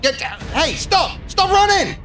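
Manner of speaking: high, urgent yell at a runner; shouted police order. Acted. Police to a fleeing suspect, shouted order. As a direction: shout it after someone getting away.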